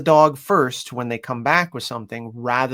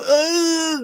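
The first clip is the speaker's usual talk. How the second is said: mock alarmed grunt; 5 dB louder than usual. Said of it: "they go".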